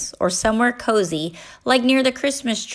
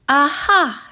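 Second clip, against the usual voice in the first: a bright, louder aha of discovery; triumphant exclamation; 6 semitones higher, 4 dB louder than usual. A narrator's reading. Mama finds the face on the log, triumphant. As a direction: crow over being right. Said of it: "she exclaimed".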